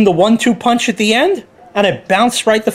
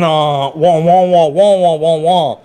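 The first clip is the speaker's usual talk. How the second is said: mocking Joe babble; 3 semitones lower than usual. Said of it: "Sounds exactly like Joe".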